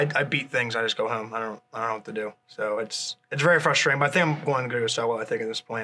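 ordinary talk

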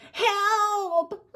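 very high, drawn-out cry, trailing into whimpers; trapped little fox crying out; cry for help. A narrator's reading. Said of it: "he cried".

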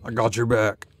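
lightly mimicked bassy game-character voice; bassy dude voice. Player mimicking the dude in the game, lightly bassy. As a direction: echo the line with a touch of put-on bass. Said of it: "So much bass in that, in that dude's voice".

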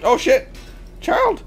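very loud, high shout of shock; real high-pitched startled outburst; startled yell. Real, not acted. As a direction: yell out as something jumps at you. Startled by child in game.

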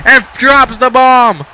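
ordinary delivery

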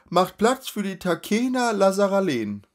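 high, loud herald announcement; crier's shout. Acted. A crier announcing the noble lady's litter, loud proclamation. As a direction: announce the lady to the whole street.

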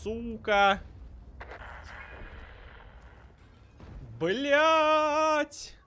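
high, loud furious screams; raging gamer. Real, not acted. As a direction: scream each curse out in full rage. Tank destroyed, screaming in rage.

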